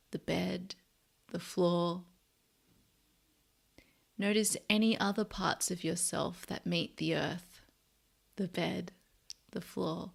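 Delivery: soft, unhurried meditation voice with long pauses; guided meditation. Real, not acted. Host leading listeners to notice body and breath, soft meditation voice. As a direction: guide them gently into body and breath, quietly.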